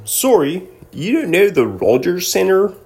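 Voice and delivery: high, hammed-up polite Canadian accent. Host imitating a Canadian listener, polite mock Canadian accent. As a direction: apologize sweetly in a put-on Canadian accent. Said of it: "No, you have to be polite about it".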